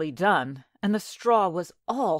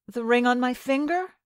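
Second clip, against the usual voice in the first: put-on higher young girl voice; maiden; 6 semitones higher than usual. A narrator's reading. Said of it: "said she".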